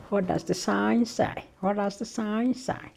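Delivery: imitated Scottish accent, high-pitched; Kevin Bridges. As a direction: do a high Scottish comedian bit. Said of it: "That was a bit from Kevin Bridges".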